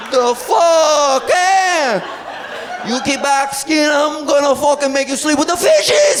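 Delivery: mock mafioso threatening dad over tomatoes; Italian-American accent. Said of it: "Why are you talking like that?".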